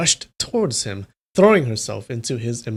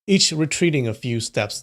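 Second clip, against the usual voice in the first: plain reading voice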